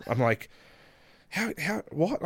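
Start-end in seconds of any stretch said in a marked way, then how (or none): 1.3-2.2 high, softer stammer of disbelief; retold disbelief. Wrestler reacting to the Wrestle Kingdom invite, in disbelief. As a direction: stammer it high, as if you can't believe it. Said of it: "I couldn't believe it".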